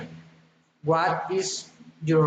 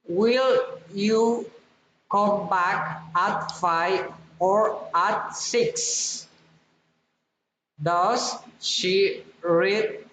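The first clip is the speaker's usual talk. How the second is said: first choice rises, last choice after "or" falls; rising then falling. Shown as a demonstration. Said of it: "rising and falling intonation".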